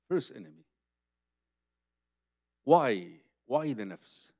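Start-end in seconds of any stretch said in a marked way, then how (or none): none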